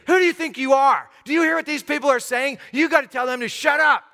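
loud, high indignant demand; angry confrontation. Acted. Religious leaders demanding Jesus silence the crowd, angry.

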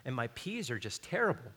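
soft, low mock lament; imitated gardener's complaint. Preacher voicing home gardeners puzzled by crops, quiet mock complaint. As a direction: grumble it quietly, as someone else. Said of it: "You're like".

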